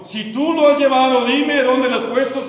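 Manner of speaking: loud, high, insistent demand; voicing a desperate appeal, pitched high; urgent plea. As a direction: plead at full preaching voice. Acts Mary's plea to the gardener.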